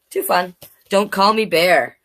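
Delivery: plain reading voice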